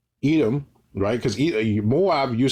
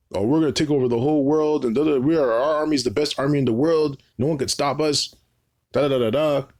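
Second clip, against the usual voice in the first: higher, swaggering put-on bragging voice; mock boastful American; 4 semitones higher than usual. Preacher mocking Americans boasting they'll take over the world, higher swaggering voice. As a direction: parody a cocky American bragging about his army. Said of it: "Who talks like this? America".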